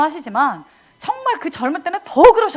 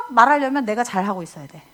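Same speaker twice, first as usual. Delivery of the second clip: ordinary talk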